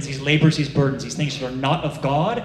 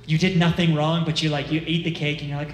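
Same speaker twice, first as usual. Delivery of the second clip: usual speaking voice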